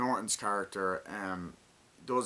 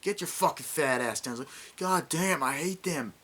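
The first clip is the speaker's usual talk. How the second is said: mock angry yell; 7 semitones higher, 4 dB louder than usual.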